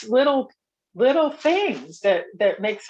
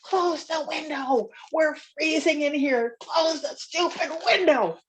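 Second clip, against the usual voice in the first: mock shouting; 6 semitones higher than usual. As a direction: imitate a friend yelling to shut it, drawn out. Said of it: "my friend started shouting at him".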